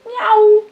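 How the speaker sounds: high, loud cat meow. Pretend. Meowing to the cat.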